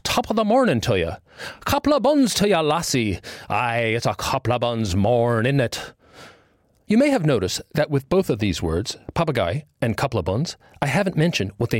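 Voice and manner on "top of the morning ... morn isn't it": put-on light Irish accent, jolly and cheery; a cheery greeting in a lightly put-on Irish accent. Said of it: "it could be a jolly greeting".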